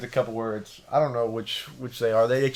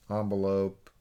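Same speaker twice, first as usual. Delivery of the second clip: ordinary talk, nothing marked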